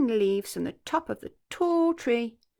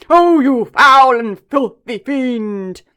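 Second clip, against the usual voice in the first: much louder, very slow outraged booming; cried out; 4 semitones higher, 18 dB louder, much slower than usual. Acted. Elephant crying out at the croc, loud and outraged. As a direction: roar the insult slowly. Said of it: "cried Chunky".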